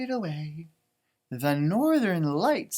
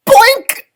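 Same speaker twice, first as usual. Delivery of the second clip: squeaky high shouted boink; cartoon boink; high boink sound; 15 dB louder than usual. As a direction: pop the boink up high and loud. Sound effect word in story.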